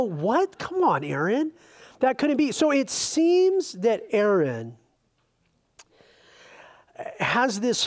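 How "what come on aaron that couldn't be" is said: high, disbelieving What? that rises sharply; incredulous. Retold or imitated. Reader reacting to Aaron's calf story, high mock disbelief. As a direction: act out a reader who cannot believe the excuse. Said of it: "And you go".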